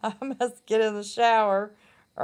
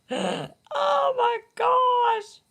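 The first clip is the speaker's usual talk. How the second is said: laughing; 13 semitones higher, much slower than usual. Real, not acted. Cracking up at herself.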